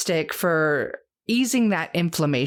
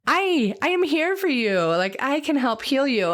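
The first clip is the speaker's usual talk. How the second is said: higher-pitched, playful plant offering help; talking plant; 8 semitones higher than usual. Acted. Lungwort offering healing to a sick hiker, playful high voice. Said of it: "that lungwort is just like".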